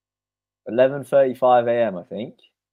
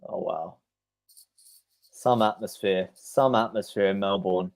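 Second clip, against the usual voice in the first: ordinary talk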